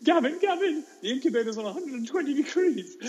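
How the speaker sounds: high, panicked kid's voice imitated; mock screaming schoolboy, high-pitched; screaming little boy. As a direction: squeal it like a child raising the alarm. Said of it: "little Billy is at the door screaming".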